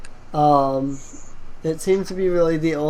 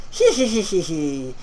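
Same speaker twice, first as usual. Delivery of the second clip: skeleton cackle. Acted. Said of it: "He starts cackling at you".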